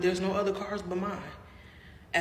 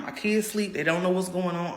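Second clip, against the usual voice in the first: usual speaking voice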